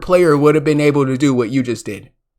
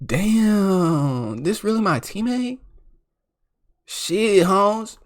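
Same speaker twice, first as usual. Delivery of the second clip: imitating the teammate in a slipped-in street accent, softer; put-on accent; 6 dB quieter, slower than usual. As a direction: mutter it in a put-on accent, mock-dismayed. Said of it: "I don't know why I had that accent".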